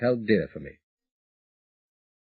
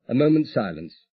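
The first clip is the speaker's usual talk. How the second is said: plain reading voice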